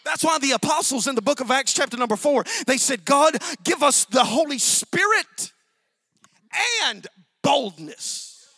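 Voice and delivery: loud, surging pulpit delivery; full-force preaching. Acted. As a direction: drive the story home from the pulpit. Preaching Jeremiah's calling.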